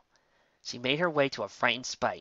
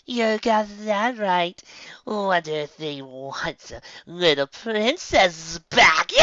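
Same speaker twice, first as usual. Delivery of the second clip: slow, louder, higher mocking taunt; taunting villain mare; 7 semitones higher, 5 dB louder, much slower than usual. A narrator's reading. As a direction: drag it out to mock them.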